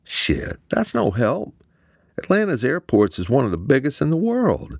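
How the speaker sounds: high, deflated complaint; frustrated. A narrator's reading. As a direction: groan it out, hope slipping. Eamon despairs.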